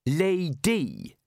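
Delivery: careful, deliberate. A narrator's reading. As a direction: place the word down with deliberation. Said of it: "uttered the word carefully and with deliberation".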